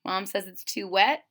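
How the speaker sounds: ordinary delivery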